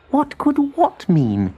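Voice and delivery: high, slow absent voice; distracted spider. A narrator's reading. Abigail answers from her spellbook, distracted. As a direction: answer without looking up.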